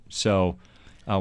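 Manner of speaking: ordinary delivery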